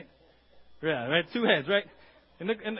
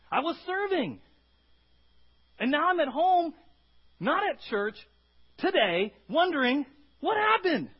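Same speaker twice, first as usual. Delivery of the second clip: loud, high pleading complaint; whining complaint; 11 semitones higher, 7 dB louder than usual. Retold or imitated. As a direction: plead with God, distressed and confused. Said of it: "we're like".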